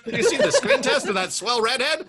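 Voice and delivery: high, laughing 1940s movie mogul; old-timey studio boss. Pretend. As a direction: pitch it like an old Hollywood mogul. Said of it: "old-timey voice".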